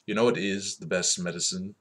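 usual speaking voice

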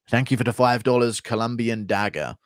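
usual speaking voice

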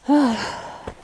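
a weary, drawn-out sigh; pained sigh. Real, not acted. Hiker just bitten by a caterpillar, pained sigh. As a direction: breathe out the sting of the bite.

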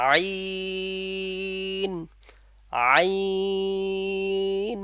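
আইন held long and rising, four alif lengths; drawn-out ain. Shown as a demonstration. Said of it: "উচ্চারণের সময় চার আলিফ পরিমাণ টানব".

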